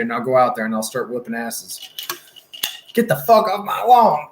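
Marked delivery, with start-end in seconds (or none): 2.9-4.3 put-on higher, louder cranky old man yell; Bad Grandpa. Streamer pretending to chase neighborhood kids off lawn, cranky old yell. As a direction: holler at the kids like a cane-waving grandpa. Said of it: "I'll dress, dress up as that dude".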